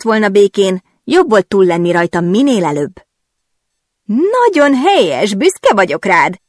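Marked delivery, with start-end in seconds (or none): 4.0-6.4 high, slow, laughing exclamation; bursting out laughing. Acted. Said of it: "csattant fel nevetve". (nevetve felcsattanva)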